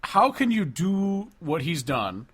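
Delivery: ordinary delivery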